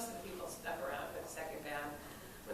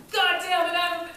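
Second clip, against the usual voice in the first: high, loud angry curse; spat-on singer cursing in anger; furious outburst; 10 semitones higher, 13 dB louder than usual. Retold or imitated. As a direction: blurt it in shock and rage.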